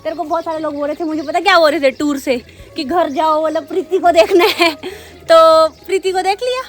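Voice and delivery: fast, high and loud playful teasing; excited teasing. Real, not acted. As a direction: tease her, loud and quick. Teasing the person being filmed. (तेज़, ऊँची आवाज़ में छेड़ना)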